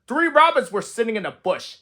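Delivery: ordinary delivery, nothing marked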